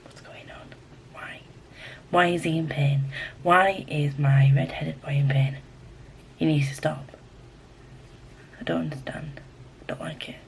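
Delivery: hushed whispered fretting, soft. Real, not acted. Reader whispering worriedly to herself about the book, whispered. Reacting quietly to book mid-read.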